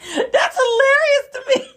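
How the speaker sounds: high squealing laugh through the words, slow; can barely get it out for laughing. Real, not acted. Vlogger cracking up at Obama's question, laughing high.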